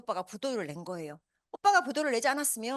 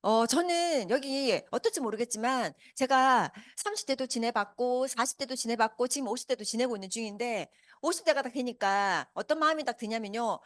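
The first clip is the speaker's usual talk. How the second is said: normal talking voice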